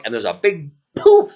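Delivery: ordinary delivery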